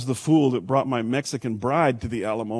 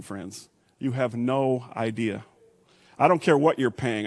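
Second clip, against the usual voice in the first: normal talking voice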